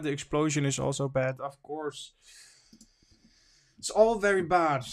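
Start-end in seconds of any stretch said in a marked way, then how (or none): 3.8-4.8 higher and louder; emphatic. Real, not acted. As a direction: say it with weight, raised up. Repeats line, first take raised.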